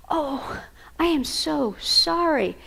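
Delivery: high, slow, heartfelt "I am so sorry"; modeled apology. Shown as a demonstration. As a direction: show a child what a sincere sorry sounds like, slowly. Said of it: "I want to model being sorry".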